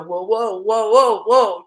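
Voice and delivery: hammed-up cry to bait mum into yelling; teasing whoa. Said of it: "he'd go".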